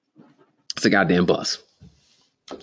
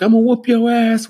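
mock threat; 7 dB louder than usual. Parent threatening the kid.

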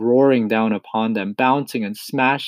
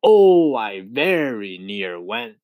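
dramatic exclaimed repeat; slower than usual. A narrator's reading. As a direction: cry out the first word, then draw out the rest. Centipede repeats line for dramatic effect.

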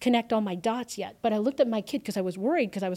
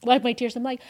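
ordinary delivery, nothing marked

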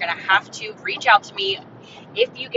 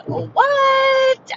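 very high, drawn-out squealed shout; hyped mock amazement. Podcaster getting listeners amazed at thirty thousand dollars, hyped shriek. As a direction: stretch it into a playful high shriek of disbelief.

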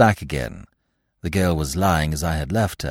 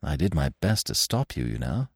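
softer, lower, gentle; gently; 6 semitones lower, 7 dB quieter than usual. A narrator's reading. Narrator gently reminds the girl he warned her. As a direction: soothe her kindly. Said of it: "I said gently".

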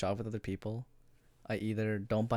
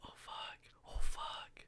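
whispering; 4 dB quieter, much slower than usual. Real, not acted. Whispered dismay about Mother's Day.

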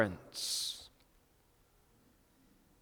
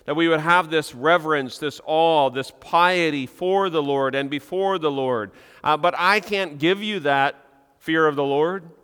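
louder, higher preaching with slowed stressed words; fervent preaching; 4 semitones higher, 4 dB louder than usual. Acted. Pastor urging his congregation, louder fervent preaching. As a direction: preach it out with passion.

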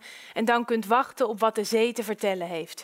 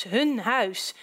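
plain reading voice

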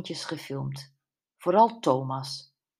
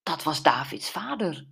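ordinary delivery, nothing marked

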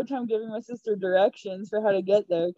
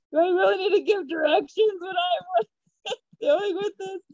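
high-pitched incredulous complaint, laugh breaking in; hammed-up indignant question; mock exasperation; 10 semitones higher than usual. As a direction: protest in disbelief, amused. Retells exasperation at giving directions.

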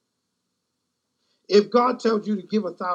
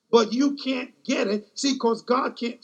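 ordinary talk, nothing marked

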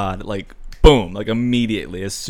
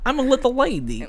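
high, little-lady game character voice; high-pitched video game heroine impression; Misfortune; 13 semitones higher, faster than usual. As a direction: put on a tiny, high girlish character voice. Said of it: "that fucking video game you played".

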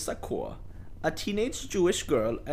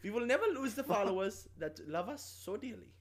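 put-on German accent; 4 semitones higher, 5 dB quieter than usual. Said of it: "putting on a very offensive accent".

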